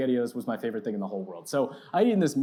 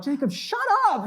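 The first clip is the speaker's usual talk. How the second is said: high, loud, urgent shushing yell; comically desperate outburst; retold yell; 5 dB louder than usual. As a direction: act out a mortified kid silencing his friend. Retold panicked retort to friend.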